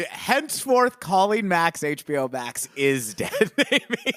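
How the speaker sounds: mock-formal decree breaking into laughter; laughing mock decree. Real, not acted. Host joking to co-host about a renamed channel, laughing decree.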